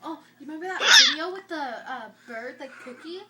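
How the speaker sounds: giggling through the story; laughing while talking. Real, not acted. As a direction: talk through your laughter. Retelling the funny video.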